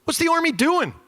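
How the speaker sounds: high, exasperated questions; a pilot fed up the Army hasn't moved, high and incredulous. Retold or imitated. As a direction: demand it in disbelief. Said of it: "He goes".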